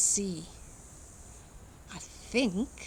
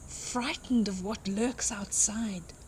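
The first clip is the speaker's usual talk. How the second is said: usual speaking voice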